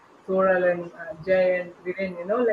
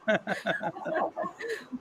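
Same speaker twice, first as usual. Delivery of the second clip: a short, high, airy laugh at herself; amused laugh after joking about being the villain; real laugh; 4 semitones higher, 10 dB quieter than usual. Real, not acted. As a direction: laugh it off lightly. Laughs at her own villain role.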